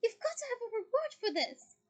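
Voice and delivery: high, loud doting mum; fond mother. Acted. Mother fondly rewarding her son. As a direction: say it fondly, beaming. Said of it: "said Missus Weasley fondly".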